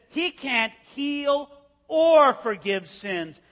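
slow, smug, gloating; smug scribes. Retold or imitated. Religious leaders gloating at Jesus's failure, slow and smug. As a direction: drag it out with a sneer. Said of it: "would have smiled and they would have said".

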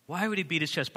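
usual speaking voice

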